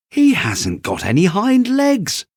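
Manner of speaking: high, loud mocking call; wild rabbit calling out. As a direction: jeer to the others. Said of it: "he called out".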